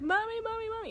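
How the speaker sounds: very high mock wail for Mommy; imitated crying toddler. Mother imitating her toddler at night, mock wailing. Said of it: "she starts screaming again and crying".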